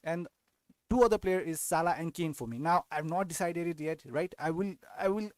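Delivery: normal talking voice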